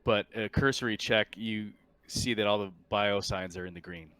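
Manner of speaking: ordinary talk, nothing marked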